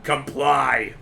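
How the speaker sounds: low flat robotic command; deep machine voice order. Retold or imitated. Imitating the artifact voice.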